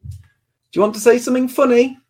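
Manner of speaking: playful daddy voice. Real, not acted. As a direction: pitch it up to coax a little one. Coaxing small child to perform.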